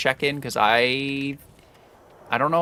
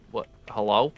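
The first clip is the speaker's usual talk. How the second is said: normal talking voice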